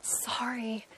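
soft whispered apology; whispered sorry. Real, not acted. Anna whispers an apology while he records. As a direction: breathe the apology, barely audible.

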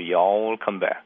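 "You all" merged into one long Southern y'all; slow Southern drawl, stretching "all" out long. Shown as a demonstration. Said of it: "길게 늘어가지고".

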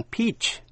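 "peach" with a long "ee" vowel. Shown as a demonstration. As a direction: say "peach" as PEECH. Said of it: "có thể đọc là E".

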